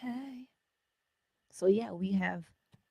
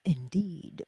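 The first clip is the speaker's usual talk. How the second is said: ordinary talk, nothing marked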